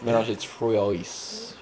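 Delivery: slow, soft "trois" sounded out with its S; rapper's mispronunciation. Retold or imitated. Quoting the rapper's line, "trois" mangled with the ending sounded. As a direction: draw out "trois" as if spelled troy-ees. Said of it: "Well, he says".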